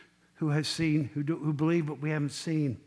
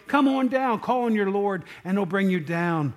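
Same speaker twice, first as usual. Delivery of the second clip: crowd yelling; 3 semitones higher, 4 dB louder than usual. Acted. Crowd yelling at Jesus on the cross, a raised taunting call. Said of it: "the people are yelling at Jesus".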